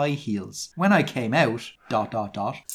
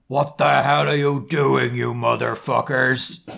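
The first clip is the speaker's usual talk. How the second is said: put-on indignant headmaster voice, dragging the last word; mildly hammed-up Dumbledore outrage; outraged Dumbledore. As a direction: burst in on them, mock outraged. Dumbledore voiced catching the couple.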